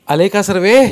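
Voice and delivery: high, loud question to all; calling to a group. A narrator's reading. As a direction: raise your voice to check on the whole gathering. Elder calls to gathered group.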